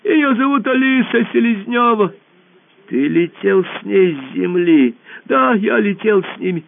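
high squeaky little voice; ball answering the emperor in a squeak. Acted. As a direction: squeak nervously, small and high. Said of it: "пропищал шар".

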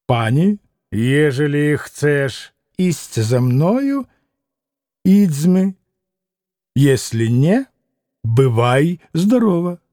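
slow, measured Polish-laced speech; dignified, deliberate farewell offer; stately ultimatum. Acted. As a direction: pause pompously between phrases. Pompous pan's formal ultimatum in Polish.

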